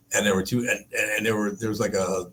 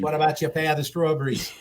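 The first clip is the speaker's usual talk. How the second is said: higher ad quote with laughter in it; half-laughing repeat of the line; laughing spoof; 6 semitones higher than usual. Retold or imitated. Host repeating the ad line while still laughing. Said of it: "Pepperidge Farm remembers".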